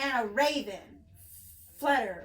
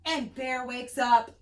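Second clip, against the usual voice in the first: usual speaking voice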